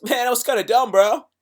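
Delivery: a friend teasing someone for a dumb move, mocking; teasing bro. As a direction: rib him like a buddy. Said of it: "somebody goes".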